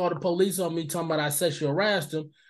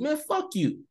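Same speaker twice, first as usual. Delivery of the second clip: mock dismissive retort; 11 semitones higher, slower than usual. Acted retort to shopper's attitude.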